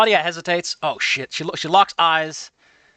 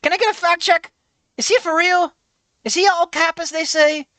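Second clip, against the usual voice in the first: put-on high, eager little-kid voice; five-year-old boy; 10 semitones higher than usual. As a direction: play a cheeky five-year-old asking his mom. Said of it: "Malcolm, you're five years old".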